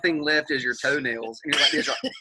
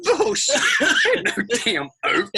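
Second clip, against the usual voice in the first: high, scornful scoff; 7 semitones higher, 4 dB louder than usual. Real, not acted. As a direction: dismiss it with scorn. Scoffing at tasting note.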